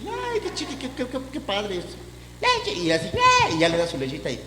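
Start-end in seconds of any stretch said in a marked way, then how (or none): 0.1-2.8 put-on high, soft cooing at a baby; sugary mock baby-talk voice, much higher. Cooing over a chubby baby. 3.1-3.5 sugary mock baby-talk voice, much higher. Cooing over a chubby baby.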